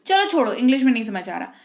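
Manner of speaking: usual speaking voice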